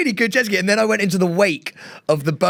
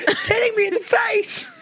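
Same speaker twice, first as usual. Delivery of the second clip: laughing mock wail. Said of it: "Pete was going".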